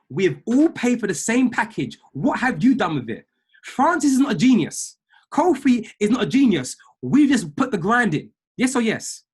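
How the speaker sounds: rally the group like a coach; fired-up push. Real, not acted. Coach firing up trading group, loud rally.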